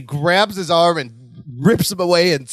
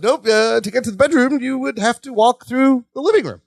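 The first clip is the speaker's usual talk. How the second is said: higher, nervous over-explaining; panicky Larry; 6 semitones higher than usual. Retold or imitated. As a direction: stall her with a squeaky, too-quick excuse. Panicked Larry blocks Jennifer.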